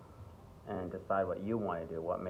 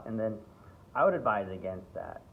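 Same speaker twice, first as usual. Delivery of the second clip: ordinary talk, nothing marked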